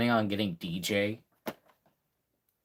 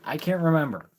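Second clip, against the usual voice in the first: louder, higher, exasperated; frustrated outburst; 6 semitones higher, 5 dB louder than usual. Real, not acted. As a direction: blurt it out in frustration. Frustrated at forgetting.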